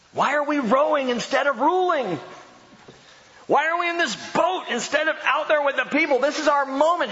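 high, whiny complaining voice; grumbling apostles. Retold or imitated. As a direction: gripe like a restless disciple.

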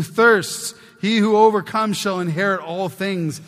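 loud, ringing pulpit reading of the verses; preaching scripture. Acted. Preacher declaiming Revelation to his church, loud and ringing. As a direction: proclaim each line to the back pew. Reads scripture at full force.

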